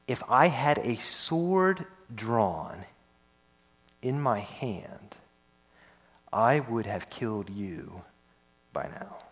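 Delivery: mild, understated anger; quiet menace. Retold or imitated. Preacher voices angry Balaam threatening his donkey, slow and soft. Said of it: "He's mad".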